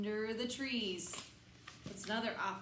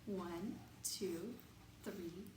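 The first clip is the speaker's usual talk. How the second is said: soft counting; 4 semitones lower, 11 dB quieter, slower than usual. Acted. Quiet counting along with kids.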